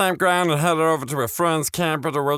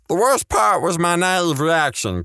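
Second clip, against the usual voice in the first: plain reading voice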